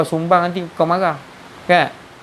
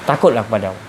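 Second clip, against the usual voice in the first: ordinary delivery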